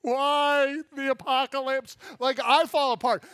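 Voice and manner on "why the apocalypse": much louder and higher, slow overblown despair; melodramatic wail. Retold or imitated. Preacher mocks his own smashed-thumb fuss, loud, slow and overblown. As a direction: make a smashed thumb the end of the world. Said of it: "I'm like".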